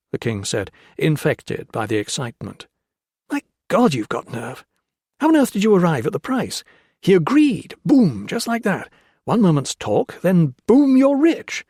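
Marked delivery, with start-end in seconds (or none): 3.3-11.6 high, loud, tumbling out in amazement; can't believe the luck, bursting with it; breathless thrill at a fortune; excited disbelief. Acted. Peter thrilled by the king's deal.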